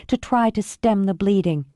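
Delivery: ordinary delivery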